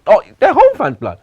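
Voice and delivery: hammed-up taunt at the home fans; mock defiant player. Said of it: "he's like".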